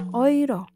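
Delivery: euro said OY-ro; German Euro. Shown as a demonstration. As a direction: open euro with oi. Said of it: "tidak dibaca euro ya". (euro dibaca "oiro")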